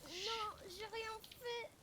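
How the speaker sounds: high, drawn-out mock protest; pleading denial. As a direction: plead innocence in a slow whine. Pretend accused protests innocence.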